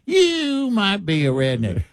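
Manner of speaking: high, drawn-out Southern comedian impression; Jeff Foxworthy. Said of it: "and Jeff Foxworthy".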